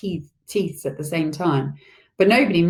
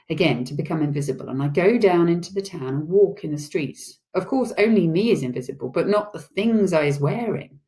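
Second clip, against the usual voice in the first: ordinary delivery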